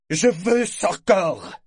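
loud, high, drawn-out demand; spoiled child. A narrator's reading. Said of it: "entendre un enfant trop gâté".

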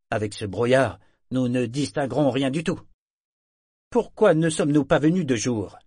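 Fjord, doubtful. A narrator's reading. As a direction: push back, annoyed by the fog. Fjord objects to the fog.

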